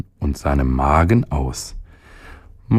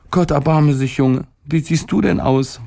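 high-pitched, startled mother's cry; alarmed mother; 8 semitones higher than usual. A narrator's reading. Mother finds her boy looking dreadful, alarmed. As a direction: exclaim in a high mother's voice, not shouting. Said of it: "rief sie".

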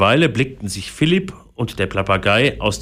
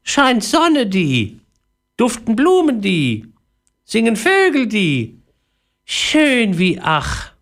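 high, slow, falling parrot phrases; babbling parrot; 13 semitones higher, slower than usual. A narrator's reading. Sated parrot babbles in grass, high dreamy voice. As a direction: muse in a drawn-out bird voice. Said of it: "plapperte er vor sich hin".

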